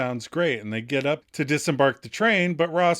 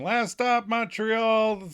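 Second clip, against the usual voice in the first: higher, called-out announcement; conductor announcing; 7 semitones higher, much slower than usual. Retold or imitated. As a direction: announce the last stop like a conductor. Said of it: "walks down the aisle announcing".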